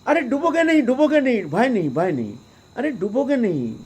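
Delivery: high, loud call, laughing as he shouts; a saint calling out with glee. Retold or imitated. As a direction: cry out in a burst of amused delight. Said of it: "हंस करके चिल्ला उठे".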